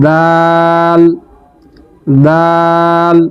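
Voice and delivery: দাল with the a held for three alif lengths; sustained Quranic দাল; drawn-out dal. Shown as a demonstration. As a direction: stretch দাল slowly and evenly. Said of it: "এটি তিন আলিফ টেনে পড়তে হয়".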